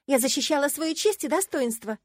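high, loud self-justification; exclaiming. A narrator's reading. Heroine defending her honour to Marcus, exclaiming. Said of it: "воскликнула я".

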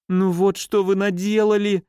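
высоко, с причитанием в голосе; запричитать в испуге; дрожащим голосом причитает. A narrator's reading. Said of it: "дрожащим голосом запричитала госпожа Умбер". (сиделка причитает дрожащим голосом)

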